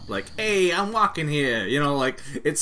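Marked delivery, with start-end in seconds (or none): 0.4-1.7 high, loud New York tough guy; Brooklyn accent. Retold or imitated. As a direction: throw in an overdone Brooklyn accent. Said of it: "that overdone Brooklyn accent".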